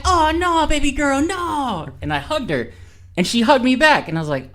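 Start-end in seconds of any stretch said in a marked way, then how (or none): 0.0-1.9 high-pitched consoling coo; mock cooing sympathy. Comforting a crying girl at the bar, high-pitched sympathy. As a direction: gush with pity for a crying stranger.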